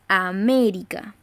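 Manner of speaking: "América" drawn out slowly, weight on MÉ; stressed antepenultimate syllable. Shown as a demonstration. Teacher showing where the voice loads, slow stressed MÉ in "América". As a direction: stretch "América" and press on MÉ. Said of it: "cargamos la voz en la antepenúltima sílaba".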